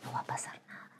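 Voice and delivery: self-reassuring whisper; hushed promise to herself. Retold or imitated. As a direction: whisper it to the mirror. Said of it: "me miré en el espejo y dije".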